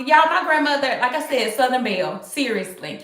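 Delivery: usual speaking voice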